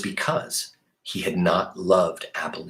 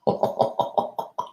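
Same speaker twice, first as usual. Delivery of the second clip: soft breathy laugh; 4 dB quieter than usual. Real, not acted. Reader laughs at story moment.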